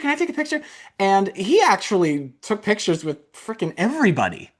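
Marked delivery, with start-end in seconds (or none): 0.0-0.6 much higher, eager parent asking. Retold or imitated. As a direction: pester like an excited parent. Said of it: "so many parents kept approaching him".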